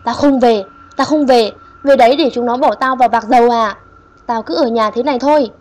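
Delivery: loud, stubborn snapping refusal; possessed grandma refusing to leave, sharp; gắt gỏng quát lên; snapping angrily. Acted. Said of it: "bà gắt lên".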